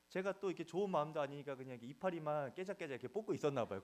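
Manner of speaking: normal talking voice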